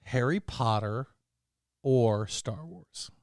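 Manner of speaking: normal talking voice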